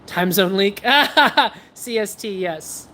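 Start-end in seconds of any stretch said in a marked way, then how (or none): 0.8-1.7 short, loud burst of laughter. Real, not acted. Reading a chat comment about time zones, loud laugh. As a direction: laugh out loud, high and brief.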